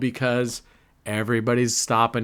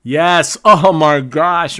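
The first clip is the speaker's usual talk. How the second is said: loud, elated cry of triumph; real jubilant outburst; excited win; 4 semitones higher, 10 dB louder, slower than usual. Real, not acted. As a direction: celebrate a win at full volume, raised pitch. Big win on the wheel.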